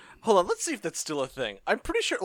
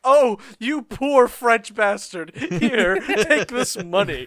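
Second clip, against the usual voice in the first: slow, pitying, louder; pitying stranger; 6 semitones higher, 6 dB louder, slower than usual. Retold or imitated. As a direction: pity him slowly. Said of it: "someone walks up and like".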